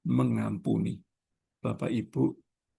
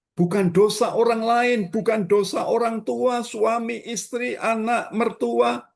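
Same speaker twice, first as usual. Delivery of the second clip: high, loud preacher's cry to the congregation; fiery preaching; 10 semitones higher, 4 dB louder than usual. Acted. Pastor urges the congregation, preaching at full force. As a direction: lift the voice and preach it hard.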